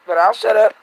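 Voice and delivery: ordinary delivery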